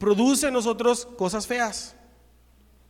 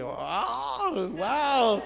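drawn-out, rising awe; retold astonishment; amazed wow; 4 semitones higher than usual. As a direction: let the wonder swell into a wow. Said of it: "fue increíble".

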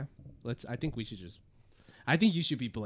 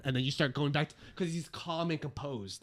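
ordinary delivery

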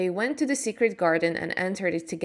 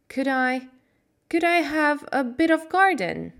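higher, slightly shaky plea; voice shaking; 7 semitones higher, 4 dB louder than usual. Acted. Mary asking her uncle for a garden, voice shaking a little. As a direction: ask with a small tremble. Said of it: "her voice shook a little".

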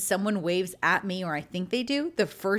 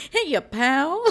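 loud, higher, put-on buddy voice; hammed-up greeting to cover a forgotten name; mock-chummy hello, pitched up; awkward chummy greeting; 5 semitones higher, 5 dB louder than usual. Joking back with an awkward greeting.

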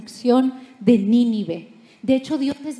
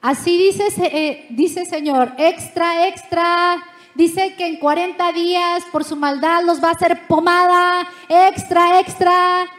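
pregonero que grita más alto y fuerte; newsboy crying extra; 5 semitones higher, 5 dB louder than usual. Retold or imitated. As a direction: anuncia como vendedor de periódicos en la calle. Jonah proclaims like street vendor. (Jonás pregonando el mensaje como voceador de periódicos)

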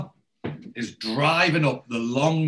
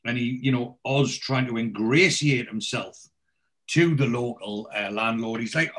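normal talking voice

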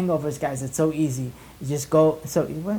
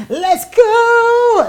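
hype shout; 9 dB louder than usual. Real, not acted. Hype sponsor shoutout yell.

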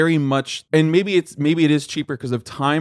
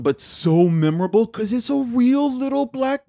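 gushing; 10 semitones higher than usual. Real, not acted. Gushing over cute movie cat.

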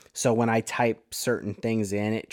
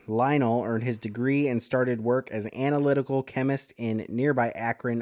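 usual speaking voice

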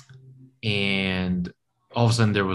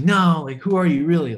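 higher and louder, pushing for an answer; the tourist pressing the stranger, higher and louder; insistent pressing; 9 semitones higher, 6 dB louder than usual. Retold or imitated. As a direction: demand it, pitched up and insistent.

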